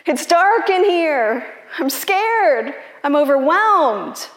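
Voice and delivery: acted scared plea, pitched high; frightened bear. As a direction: call up in panic from a pit. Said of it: "he looks up and he says".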